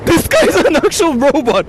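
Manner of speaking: high, laughing what the fuck; real amused shock; laughing disbelief. Real, not acted. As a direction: squeal it through laughter.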